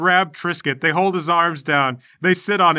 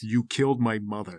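Sasha's cold retort; 5 semitones lower, 5 dB quieter than usual. Acted.

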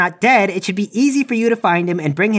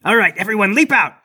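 raised-pitch triumphant taunt; gloating ambush; 2 semitones higher than usual. Acted. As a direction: crow over the guard you just fooled. Player springs the trap, gloating.

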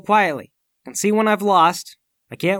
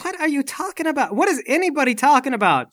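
bewildered protest; 8 semitones higher than usual. Acted. Paul confused by accusations.

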